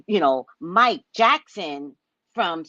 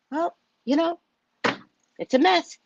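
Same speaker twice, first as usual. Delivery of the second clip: put-on pitched-up boyish whine; childish mock voice; 9 semitones higher than usual. Mocking Buttigieg's interview in a childlike voice. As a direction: talk like a hesitant little kid. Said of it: "sounded like my eight-year-old grandson".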